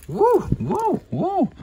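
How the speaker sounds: very high, squealed woos; excited whooping. Real, not acted. Cheering the tarantula's lightning strike with high squealed woos. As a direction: cheer like watching a knockout.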